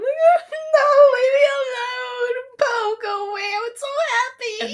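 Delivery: high, loud hammed-up wail; exaggerated sobbing shout, very high; mock crying. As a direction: wail it like a bawling cartoon girl. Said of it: "[name] starts crying".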